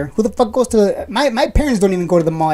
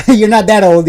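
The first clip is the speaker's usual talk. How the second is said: laughing it out, louder; laughing reassurance; 8 dB louder than usual. Real, not acted. Streamer backpedals a joke to a viewer, laughing. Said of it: "I mean, I'm joking".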